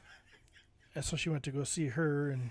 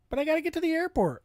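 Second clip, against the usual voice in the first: high, fast, pleading wife voice; 12 semitones higher, faster than usual. Retold or imitated. His wife protests that she has to catch her flight. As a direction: push back urgently.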